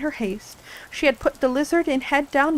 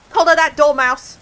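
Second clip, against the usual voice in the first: loud, high furious shriek; 5 semitones higher, 10 dB louder than usual. A narrator's reading. Queen ordering the dormouse seized, shrieking. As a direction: shriek the order out at full force. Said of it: "the queen shrieked out".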